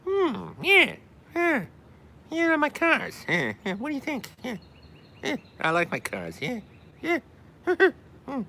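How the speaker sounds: very high-pitched celebrity impression; Jay Leno. Showing off an accent about liking cars, high caricature voice. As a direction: squeal it in short bursts, with little laughs. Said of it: "The Jay Leno accent".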